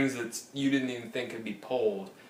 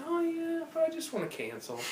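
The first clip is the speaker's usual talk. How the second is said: a much higher, singsong imitation of himself brushing her off; high mocking self; 5 dB quieter than usual. He mimics himself refusing the rep's offers, high singsong. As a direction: sing it up high, still just wanting to cancel. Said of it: "I'm just like".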